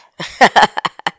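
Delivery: loud, high burst of laughter; real giggle; amused laugh. Real, not acted. Reacts to the Don Juan clip.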